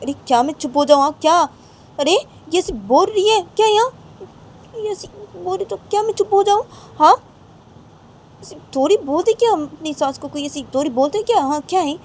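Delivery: high, loud quarrelling; acted indignant outburst. Raima's abbu refusing to be silenced, high acted indignation. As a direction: refuse to be hushed, voice pitched right up.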